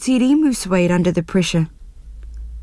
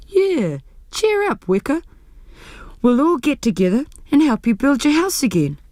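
higher, cheery encouragement; lightly voiced Rudu; 5 semitones higher than usual. A narrator's reading. Rudu cheers up Weka, offering to rebuild. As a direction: perk him up.

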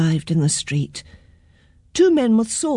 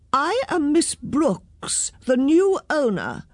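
self-important woman; 7 semitones higher, slower than usual. A narrator's reading. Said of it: "said the woman".

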